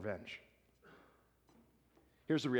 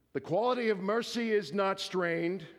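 higher, grand stage declamation; Shakespearean voice; 6 semitones higher, 4 dB louder than usual. Acted. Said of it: "let me read it in a Shakespearean voice".

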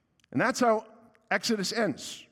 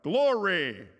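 raised, drawn-out church shout; imitated shout; 5 semitones higher, 3 dB louder than usual. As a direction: call it out high, falling away at the end. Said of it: "this guy shouts out".

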